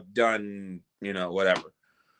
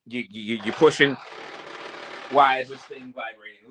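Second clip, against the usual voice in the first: usual speaking voice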